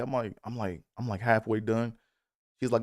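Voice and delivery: usual speaking voice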